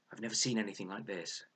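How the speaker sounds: usual speaking voice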